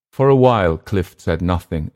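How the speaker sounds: usual speaking voice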